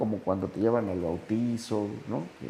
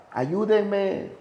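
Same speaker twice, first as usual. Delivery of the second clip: higher-pitched mock call for help; imitated cry for help; 9 semitones higher than usual. Alcoholic trapped in a pit calls to passers-by, half-shouted cry for help. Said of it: "y gritaba".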